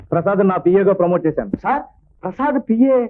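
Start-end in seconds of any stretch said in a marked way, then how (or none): 1.6-3.0 high-pitched, incredulous echo; astonished question. Acted. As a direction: repeat it in disbelief, voice jumping up. Manager hears of surprise promotion.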